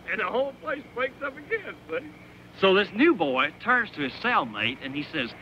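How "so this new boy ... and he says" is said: normal talking voice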